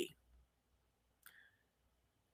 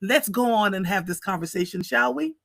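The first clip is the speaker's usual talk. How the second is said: usual speaking voice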